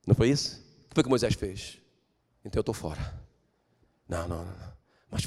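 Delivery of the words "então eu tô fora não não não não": low, soft refusal; Moses backing out. Acted. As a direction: shrug it off quietly.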